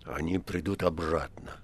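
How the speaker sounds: low, hushed reply; subdued low murmur; quietly. A narrator's reading. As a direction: answer softly, dropping the voice. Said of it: "отозвался Римский тихо".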